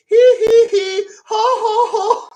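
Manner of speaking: high-pitched hee hee ho ho; high laugh. Retold or imitated. Smokey giggling at the reporter's question, very high laugh. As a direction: giggle an octave up. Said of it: "His voice raises an octave".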